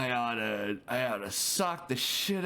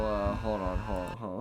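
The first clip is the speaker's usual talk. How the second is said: usual speaking voice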